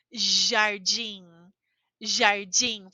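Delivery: "jardim" with the Brazilian dj sound. Shown as a demonstration. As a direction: say "jardim" as zhar-DJEEN, nasal end. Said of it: "aqui nós temos o J e aqui nós temos D I".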